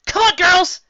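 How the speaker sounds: high, loud rallying call; rally the girls forward. Acted. Calling friends.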